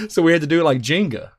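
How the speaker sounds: ordinary delivery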